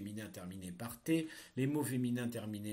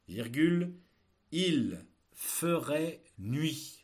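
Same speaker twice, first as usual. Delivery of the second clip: slow, loud dictation; dictating; 4 semitones higher, 6 dB louder, much slower than usual. Shown as a demonstration. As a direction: read it out for pupils to write. Teacher dictating a text.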